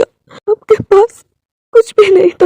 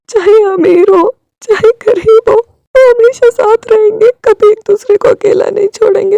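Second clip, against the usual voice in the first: tearful; 6 semitones higher, 3 dB louder than usual. Acted. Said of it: "वो रोने लगा था".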